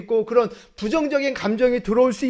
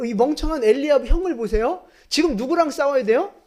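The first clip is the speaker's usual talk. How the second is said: ordinary talk, nothing marked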